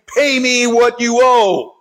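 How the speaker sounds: high, slow, drawn-out demand; stern demand. A narrator's reading. Servant demanding his debt from a fellow servant, stern drawn-out demand. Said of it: "took him by the throat".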